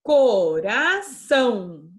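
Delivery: slow, syllable by syllable; teacher sounding out a word for pupils; slow spelling out. Shown as a demonstration. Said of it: "o A e o O na mesma sílaba".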